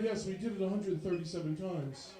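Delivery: low, formal son voice; stiff nerdy son. Retold or imitated. Son calling his dad, low and overly proper.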